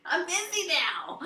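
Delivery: high, drawn-out delight; excited exclamation. Real, not acted. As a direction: exclaim it slowly, high and thrilled. Exclaims over sudden surge in sales.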